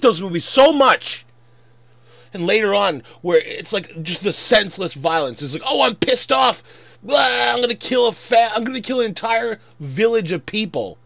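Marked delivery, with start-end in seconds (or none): 5.6-11.0 higher and louder hammed-up rage; a fan mocking the villain's rage, hammed-up shout; mock angry rant. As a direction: ham up a hothead's tantrum.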